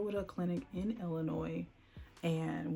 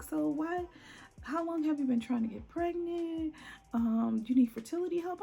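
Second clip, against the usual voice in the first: mock condescending nurse; 10 semitones higher than usual. Hospital staff questioning her.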